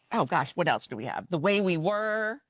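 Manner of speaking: usual speaking voice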